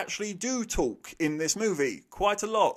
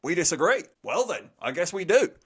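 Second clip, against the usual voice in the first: louder, huffy back-and-forth; mock bickering; 3 semitones higher, 6 dB louder than usual. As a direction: act out a petty standoff. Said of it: "these people just".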